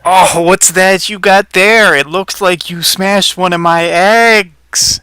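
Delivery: high, loud, drawn-out bunny voice; accusing bunny. Acted. Bunny spots its smashed egg.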